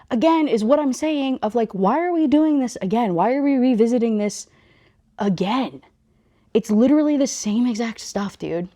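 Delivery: high-pitched exasperated complaint. Real, not acted. Reader venting about why the story revisits this, exasperated. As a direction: let the exasperation push the pitch way up. Ranting about repeated plot.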